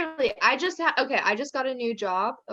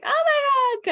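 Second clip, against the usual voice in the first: very high, loud mock squeal; much higher, giddy squeal; squealing oh my God; 5 dB louder than usual. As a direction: shriek it way up high. Imitating MJ afterwards.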